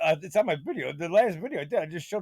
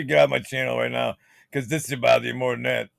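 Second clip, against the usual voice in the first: ordinary talk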